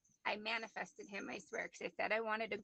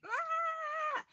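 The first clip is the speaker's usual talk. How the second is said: high, loud, held screech; long high-pitched mock wail; imitated kitten scream; 6 dB louder than usual. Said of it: "screaming really loud".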